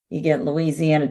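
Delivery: ordinary talk, nothing marked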